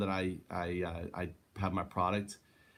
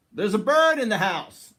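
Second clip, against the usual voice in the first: startled cry, higher and louder; surprised exclamation; 10 semitones higher, 9 dB louder than usual. Retold or imitated. Retelling seeing a wren fly into the house, startled cry. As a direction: blurt it out as the bird flies past. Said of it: "Literally, I said that".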